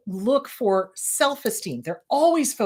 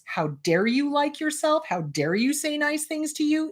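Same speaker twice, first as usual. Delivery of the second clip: mock indignant parent, a bit higher; critical parent; 6 semitones higher than usual. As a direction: scold like a disapproving parent. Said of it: "parental units that made us wrong".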